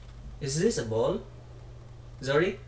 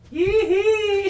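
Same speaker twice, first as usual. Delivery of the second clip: happy squeal; 8 dB louder than usual. Real, not acted. Celebrating with toddler.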